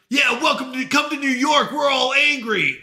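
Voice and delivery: mock a New Yorker proud of being angry; a hint of a boastful tough-city local. New Yorkers bragging about anger.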